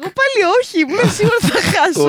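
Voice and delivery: high-pitched happy protest; excited protest. Real, not acted. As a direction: squeal it out as the surprise winner. Winner protests she expected to lose.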